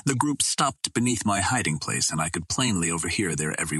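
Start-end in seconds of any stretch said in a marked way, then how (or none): none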